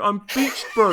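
exaggerated New Zealand impersonation; mock Kiwi accent. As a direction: lay on a thick Kiwi accent for a laugh. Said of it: "really good impersonation".

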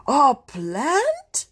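loud, high, incredulous question; scandalised mother's outcry; horrified yell. Acted. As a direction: yelp it in horror, swooping upward. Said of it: "yelled Mom in horror".

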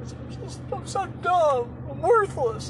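high, slow, tearful self-pity with a breaking voice; mock sobbing wail. Client crying down the phone to the mover, hammed-up sobbing. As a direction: wail like he can't hold it together.

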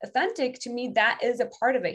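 normal talking voice

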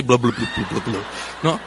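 low, burbling fish noises; deep bubbly fish babble; fish talk. Pretend. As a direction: gurgle like a little fish underwater. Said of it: "inglés y pececín".

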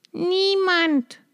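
high, falling hopeless reply; bleak answer. A narrator's reading. Sister on the tower answering, high and hopeless. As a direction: answer down from the tower without hope. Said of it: "klang die trostlose Antwort".

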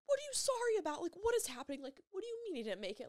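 very high, incredulous retold questions; bewildered inner panic. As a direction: re-enact disbelief, starting high and speeding up. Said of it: "thinking in my head".

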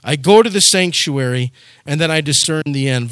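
ordinary delivery

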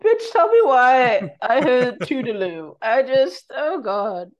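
loud, high laughing disbelief. Real, not acted. Laughing outburst at misheard name.

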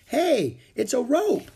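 high, loud exclamation; excited discovery. Acted. Annie spots what fell from above, excited discovery. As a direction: call out a surprise find.

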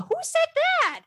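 very high, squeaky mock voice; high-pitched looping mock question; mimicking Portia. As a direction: squeak it like a repeating toy.

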